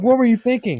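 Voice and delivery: high, loud exasperated demand; hammed-up movie argument shout; mock scolding yell. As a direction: act out chewing out a reckless friend. Retold movie quarrel, scolding.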